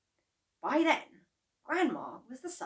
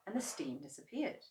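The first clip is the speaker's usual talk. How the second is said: plain reading voice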